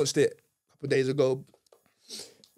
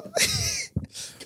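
high laugh. Real, not acted. Joking about getting club shut.

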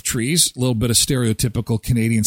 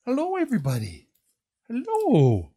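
much higher put-on dog voice; voicing the dog; 9 semitones higher, 4 dB quieter than usual. Owner voicing the birthday dog greeting viewers, high. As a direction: speak for the dog, high and sweet. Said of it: "you gonna say hi to everybody?".

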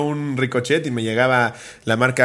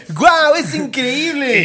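high, slow, amazed ad voice; over-the-top TV ad amazement; infomercial; 11 semitones higher, 3 dB louder, much slower than usual. Pretend. Mock toy commercial reaction.

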